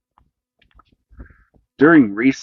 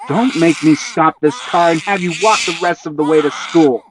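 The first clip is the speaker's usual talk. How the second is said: louder, higher threat; angry threat; 6 semitones higher, 4 dB louder than usual. A narrator's reading. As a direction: threaten to stop the car. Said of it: "even more angrily".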